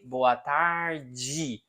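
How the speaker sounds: "Boa tarde" ending in a soft "jee"; Rio pronunciation. Shown as a demonstration. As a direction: say "tarde" as "tar-jee", Rio and São Paulo style. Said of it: "People from Rio de Janeiro, São Paulo".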